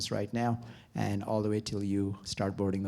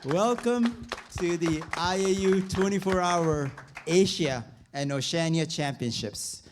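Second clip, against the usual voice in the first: high, loud, still buzzing from the chant; excited; 7 semitones higher, 5 dB louder than usual. Real, not acted. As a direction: ride the crowd's energy into the welcome. Fired up after leading chant.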